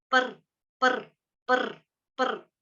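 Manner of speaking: पर repeated slowly with a half र; slow teaching drill of पर; slow par drill. Shown as a demonstration. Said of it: "तो इसको पर यू".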